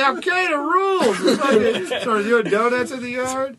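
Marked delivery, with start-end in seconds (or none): 0.0-1.2 mock yelled taunt. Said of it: "He's like".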